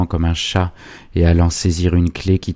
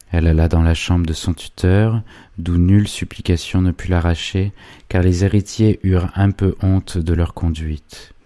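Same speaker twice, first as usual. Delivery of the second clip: usual speaking voice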